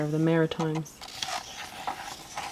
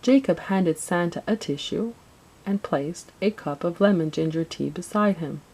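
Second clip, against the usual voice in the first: plain reading voice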